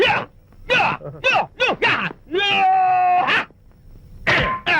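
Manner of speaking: short martial-arts style cries; fighting yells. Acted. Driver readies his special touch on the cook, fighting yells. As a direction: let out separate high attack yells.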